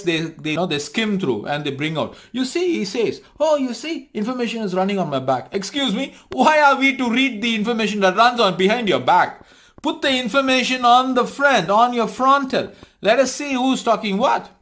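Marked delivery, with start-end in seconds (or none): 2.3-5.5 high mocking imitation of the YouTuber; mocking impression. Mimicking the content maker, high mocking voice. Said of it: "mocks the YouTuber's words". 5.5-14.5 indignant retort. Real, not acted. Retorting to YouTuber, raised scornful challenge.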